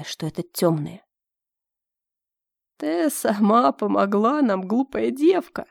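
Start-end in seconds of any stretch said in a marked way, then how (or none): 2.8-5.7 slow, rasping sepulchral voice of a dark man; rasping grave voice. Acted. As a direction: grate it out slow and menacing. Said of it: "проскрежетал тёмный с чёрными глазами, хриплым замогильным голосом".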